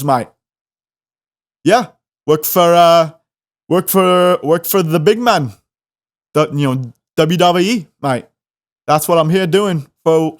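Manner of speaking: imitating a rambling Aussie wrestler; hammed-up Aussie accent, repeating as you search for words; Australian accent. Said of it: "This giant Aussie dude".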